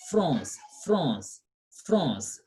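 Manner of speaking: "France" nasal ã, said low and repeated. Shown as a demonstration. Teacher drilling the class, low repeated nasal France. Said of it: "So we say what?".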